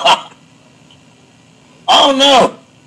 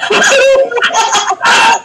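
high shrieking laugh. Real, not acted. Laughing at Scotty joke.

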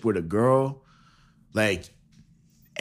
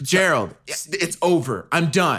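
nagging wife; 4 semitones higher than usual. Pretend. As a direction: scold him like a fed-up wife. Wife ending relationship.

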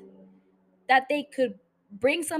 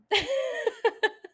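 high laugh; 8 semitones higher than usual. Real, not acted. Burst of laughter.

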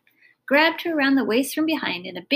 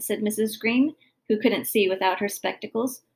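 ordinary delivery

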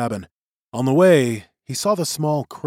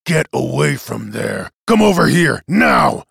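angry orders building to loud; glaring anger; 7 dB louder than usual. A narrator's reading. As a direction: bark commands, hardest on the last word. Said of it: "Bailey glared at Tim".

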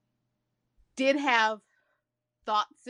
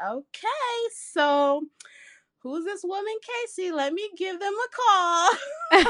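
much higher, eager retold reaction; 11 semitones higher, 5 dB louder than usual. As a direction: pitch it up, all eager. Retelling her own eager reaction.

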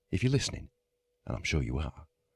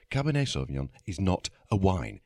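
ordinary talk, nothing marked

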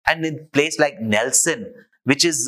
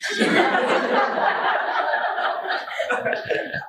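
high airy laugh; breathy real laughter. Real, not acted. Speaker laughs at his joke.

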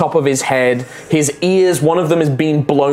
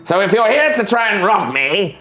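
higher, condescending goblin voice, touches of threat; smart goblin; 4 semitones higher than usual. Acted. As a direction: sound like a clever goblin tired of fools. Goblin questions the party.

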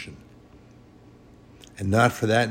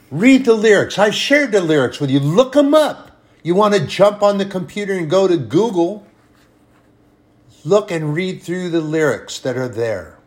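full-force preaching; 8 semitones higher, 7 dB louder than usual. Acted. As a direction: proclaim it to the congregation.

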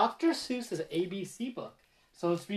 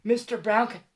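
usual speaking voice

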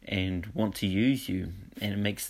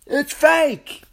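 a sudden, much higher and louder yell, building on the second; 8 dB louder, much slower than usual. Retold or imitated. Presenter retelling the big reveal to his audience, yelling out. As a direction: blurt the reveal out as a big shout. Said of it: "he just yells out".

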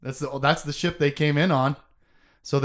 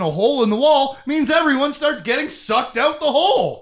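excited retelling; 9 semitones higher, 6 dB louder than usual. Retold or imitated. Excited retelling of hull breach.

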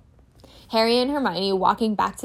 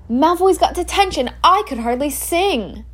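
put-on higher, louder giddy girl; gleeful Hermione; 7 semitones higher, 4 dB louder than usual. Hermione celebrating Malfoy's detention on the tower, higher and giddy. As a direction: gloat with delight.

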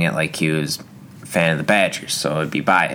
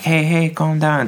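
mock calming; 10 semitones higher than usual. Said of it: "And they're like".

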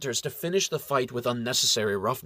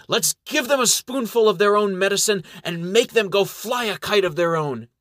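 high, raised rallying call; 7 semitones higher, 4 dB louder than usual. A narrator's reading. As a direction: call out to rouse the troops. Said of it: "she calls out".

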